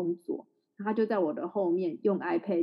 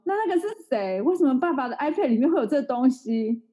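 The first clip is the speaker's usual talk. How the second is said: put-on questioning child; 5 semitones higher than usual. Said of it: "他说".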